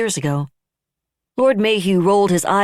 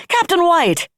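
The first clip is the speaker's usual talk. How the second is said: higher, louder hail across the room; calling out; 13 semitones higher, 4 dB louder, slower than usual. A narrator's reading. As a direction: call his name to catch his attention. Calling out to approaching officer.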